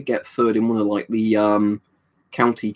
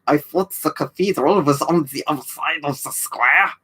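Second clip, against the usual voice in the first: slow, stiff Hollywood German officer; film-villain German, every word stretched; Hollywood German accent; 5 semitones higher, slower than usual. Retold or imitated. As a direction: Gestapo bloke in an old war film, drawn out. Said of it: "like Hollywood German".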